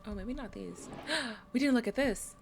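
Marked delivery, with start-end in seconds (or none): 1.1-2.4 gasp, then loud excited call; sudden discovery, loud and eager; real excitement at a new find. Real, not acted. Discovers unchecked item.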